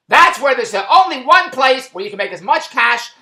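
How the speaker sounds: usual speaking voice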